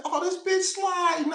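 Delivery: high, loud alarmed cry; startled shout, slowed, voice way up high; retold panic. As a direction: yelp it out as the truck starts sliding. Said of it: "I said".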